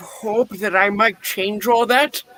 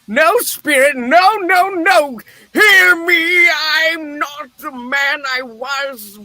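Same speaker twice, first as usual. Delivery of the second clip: high, loud terrified begging; desperate pleading cry; 6 semitones higher, 4 dB louder than usual. Acted. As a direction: cry out to the spirit in panic. Scrooge sees his own grave.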